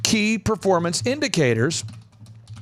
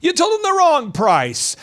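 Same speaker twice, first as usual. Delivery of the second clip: high-pitched exasperated boss; 7 semitones higher than usual. Retold or imitated. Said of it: "you're going".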